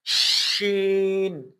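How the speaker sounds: شین with a long sh spreading in the mouth; spread shin sound. Shown as a demonstration. Teacher demonstrating the spreading شین. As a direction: let the sh of شین spread, drawn out. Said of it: "آواز منہ کے اندر پھیل جاتی ہے".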